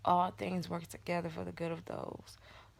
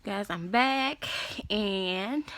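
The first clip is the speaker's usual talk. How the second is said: normal talking voice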